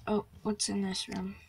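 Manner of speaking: normal talking voice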